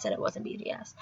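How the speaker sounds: hushed whisper; whispered punchline. Real, not acted. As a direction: drop to a whisper. Crowd stops realizing not BTS.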